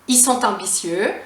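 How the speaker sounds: "ils" as "i", t linked to "ambitieux"; l dropped, liaison. Shown as a demonstration. Teacher showing plural with vowel, liaison pronunciation. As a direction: say "i sont-t-ambitieux". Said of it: "à cause de la voyelle".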